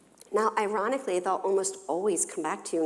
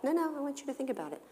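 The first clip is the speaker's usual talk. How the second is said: soft firm parent; 8 semitones higher, 8 dB quieter, much faster than usual. Retold or imitated. Parent insists to child.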